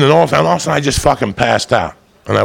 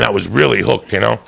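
ordinary talk, nothing marked

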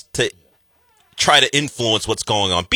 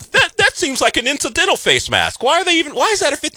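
high-pitched, louder bewildered questioning; puzzled foreign viewer, pitched very high; baffled Madrid newcomer; 13 semitones higher, 3 dB louder than usual. Acted. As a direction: play an incredulous outsider, voice way up high. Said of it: "and he's like".

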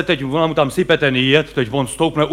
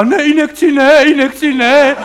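high, whiny plea against a needle; a brawny actor begging the doctor off; mock panicky squeal; pleading tough guy; 8 semitones higher, 4 dB louder than usual. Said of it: "jde doktor a říká".

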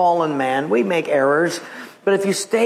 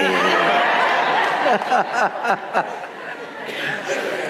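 real amused laughter; long laugh; 2 semitones lower than usual. Real, not acted. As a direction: let the laugh roll on. Long laugh after punchline.

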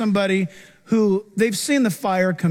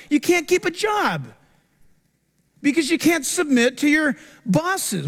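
raised, urgent sermon to the church; preaching at full force; 7 semitones higher than usual. Acted. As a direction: drive it home to the congregation.

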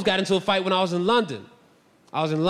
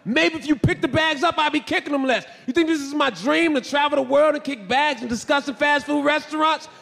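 indignant; 7 semitones higher than usual. Retold or imitated. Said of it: "I said".

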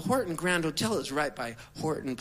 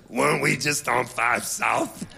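slow, lowered voice of a singer; 4 semitones lower, 4 dB louder than usual. Retold or imitated. Passenger noticing the driver's detour, imitated low and slow. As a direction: question it slowly in his put-on low voice. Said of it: "And then he goes".

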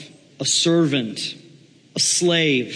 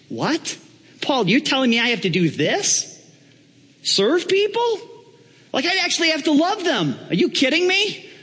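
high-pitched mock disbelief; incredulous Donald Trump; 13 semitones higher than usual. Apprentice told by Paul to serve people, incredulous. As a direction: protest in rising, hammed-up outrage.

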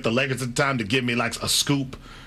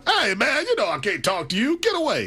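shouted, much higher hey man and get away; Magic Johnson impression; 8 semitones higher than usual. Host asks for the Magic Johnson voice again, so he shouts it high. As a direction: ham up Magic shooing a reporter off. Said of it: "Do it one more time for me".

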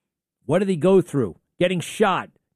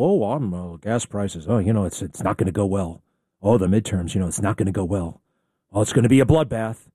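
mocking whiners; 7 semitones lower, faster than usual. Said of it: "And I got these people".

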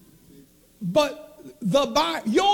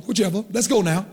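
normal talking voice

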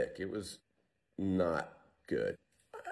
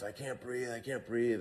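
mock distant yell; 4 semitones higher, 3 dB quieter than usual. Companion yelling up from the crater, softly mimicked yell. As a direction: imitate a choking man's call, held back in volume. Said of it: "he's yelling back up to us".

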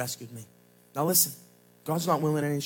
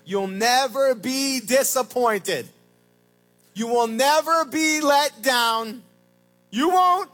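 slow, then louder and higher; rising promise; 4 semitones higher, 4 dB louder, much slower than usual. Real, not acted. Pastor assuring waiting believers, building loud. Promise to those who wait.

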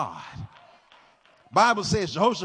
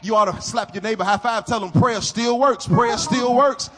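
loud, rising, whooping sermon; preaching at full force; 3 semitones higher, 10 dB louder than usual. Acted. Pastor rousing the congregation to believe in prayer, loud. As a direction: build the church up to a roar.

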